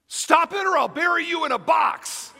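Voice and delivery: exasperated therapist shouting; sudden shouted threat. Retold or imitated. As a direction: bark the threat at her. Psychiatrist yells threat at patient.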